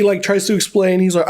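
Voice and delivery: ordinary delivery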